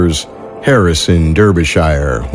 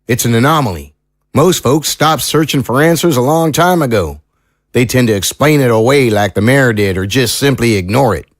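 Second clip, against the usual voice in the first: higher-pitched country lawman, thoughtful; folksy small-town sheriff, earnest; 6 semitones higher than usual. Acted. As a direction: play a seasoned sheriff weighing strange testimony. Sheriff figure in audio drama.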